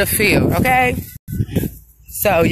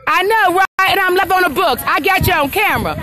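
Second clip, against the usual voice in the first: excited; 7 semitones higher than usual. Real, not acted. Vlogger pumps up Facebook viewers, excited. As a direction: hype up the live audience. Hyped while filming live.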